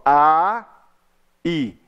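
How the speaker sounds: "a" longo, pausa, depois "i" mais baixinho; som separado. Shown as a demonstration. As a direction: diga "a... i" em dois sons isolados. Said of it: "Eu falo o som separado". ("a" e "i" separados por uma pausa)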